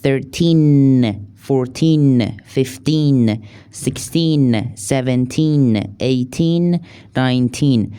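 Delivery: put-on each teen with its final n said clearly; careful final n. Shown as a demonstration. Teacher stressing the final n of the teens. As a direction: land the n at the end of every teen. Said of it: "اون انهای آخر رو حتماً دقیق تلفظ کنید".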